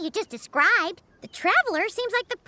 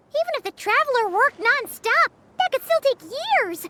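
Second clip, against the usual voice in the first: higher-pitched, exasperated objection; dismayed protest; 5 semitones higher than usual. Acted. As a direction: protest at the endless workload. Protests an endless task.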